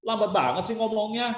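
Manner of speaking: high-pitched mock complaint; annoyed listener. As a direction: grumble like an irritated audience member. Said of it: "membuat hadirin merasa kesal dan jengkel". (keluhan hadirin yang kesal dan jengkel)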